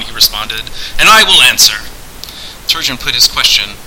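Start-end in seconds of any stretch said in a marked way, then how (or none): 1.0-1.8 loud, assured promise; grand host. Acted. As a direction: declare it with lordly confidence. Pendulum grandly agrees to answer.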